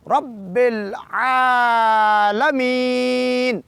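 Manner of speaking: very slow رب العالمین with the ع from mid-throat and long vowels; slow recitation demo. Shown as a demonstration. Teacher reciting the verse to show lengthening, slow chant. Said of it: "عین کو دو حرکات کے برابر لمبا".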